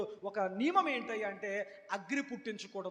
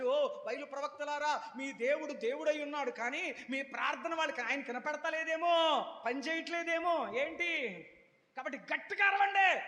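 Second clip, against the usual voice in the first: sarcastic taunt; 5 semitones higher than usual. Retold or imitated. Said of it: "అన్నాడు ఏలియా గారు". (ఏలియా బయలు ప్రవక్తలకు వెటకారంగా)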